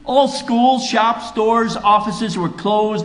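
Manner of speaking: high and loud, driving the story home; preaching at full force. Acted. Preacher telling the congregation about the prayer day, full force. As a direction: build it like a revival preacher.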